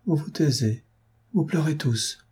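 plain reading voice

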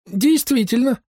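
high-pitched eager doc; doc agreeing. A narrator's reading. Doc agrees with the narrator, high-pitched voice. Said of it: "согласился со мной док".

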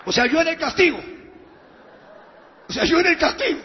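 high, loud disbelief; indignant outburst. Acted. Preacher learns he was the forfeit, loud indignant outburst. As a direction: protest it loudly, as if insulted.

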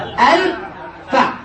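الفاء drawn out as two beats, al then fa'; slow syllable split. Shown as a demonstration. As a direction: spell out the pattern slowly with a pause. يبني الوزن مقطعًا مقطعًا. (تقطيع الوزن ببطء إلى مقطعين)

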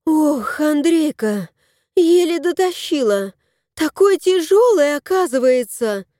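worn out after dragging it in; tired wife. A narrator's reading. Tanya telling husband the chest was heavy, high.

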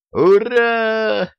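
high, loud, drawn-out cheer; jubilant yell. A narrator's reading. Brothers as the engine starts, jubilant yell. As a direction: cheer at the top of your voice. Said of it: "заорали братья".

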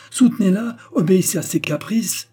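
high, loud indignant outburst; indignant sarcasm. A narrator's reading. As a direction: throw it back at a spouse, exasperated. Mother stung by husband's resistance.

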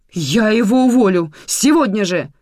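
bursting in angry. Acted. Nika bursting into the kitchen to fire Lozo, shouting. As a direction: storm in and yell it. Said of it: "ворвалась на кухню Ника".

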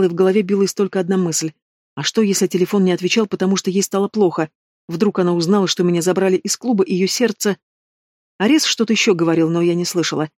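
ordinary delivery

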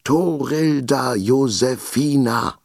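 put-on high, slow girl's yell at the top of her lungs; full-lung shout. A narrator's reading. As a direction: shout the name across the classroom. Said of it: "schrie Torrilda Josefina aus voller Lunge". (Mädchen schreit ihren Namen aus voller Kehle, hoch und langsam)

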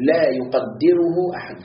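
لا يقدره أحد drawn out slowly; slow emphasis. Shown as a demonstration. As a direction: stretch لا يقدره أحد for weight. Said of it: "emphasis on greatness".

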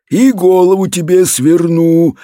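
ordinary delivery, nothing marked

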